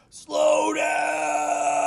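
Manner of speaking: a big high yell of the title; loud sudden shout, pitch jumping way up; shouted command. Pretend. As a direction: belt it out like a warning. Announcing the topic with a shout.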